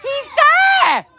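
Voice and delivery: excited shout. Real, not acted. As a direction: yell it out to everyone, thrilled. Child shouts where reindeer hides.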